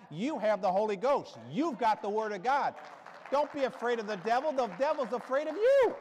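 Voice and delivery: loud, high commanding sermon; fiery preaching. Acted. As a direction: command the devil out, full force. Preaching at full force.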